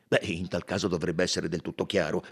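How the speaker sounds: low-pitched colonel, closing the subject; colonnello riservato. Acted. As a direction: refuse firmly in a low register. (colonnello fermo, voce bassa)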